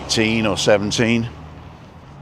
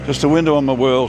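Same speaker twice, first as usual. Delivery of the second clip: slogan, higher and louder; tagline announcement; 5 semitones higher, 4 dB louder than usual. Acted. Vlogger announcing his channel motto, higher and louder. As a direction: present the show's motto.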